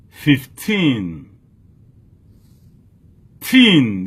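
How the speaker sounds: put-on Fifteen with a long falling TEEN, then Teen alone, loud; stress on second syllable. Shown as a demonstration. Teacher reviewing the stress for learners, emphatic teen in Fifteen. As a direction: punch and stretch -teen in Fifteen. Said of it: "sou ti là, stress là".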